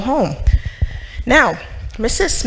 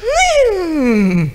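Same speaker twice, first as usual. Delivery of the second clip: mock horse whinny; 9 semitones higher than usual. Imitating a horse sound.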